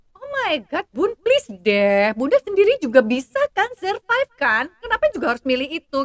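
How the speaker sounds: put-on high, loud exasperated teen voice; mock a daughter's eye-rolling plea. Daughter scolding her mother, high and exasperated. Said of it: "anak saya suka bilang gini".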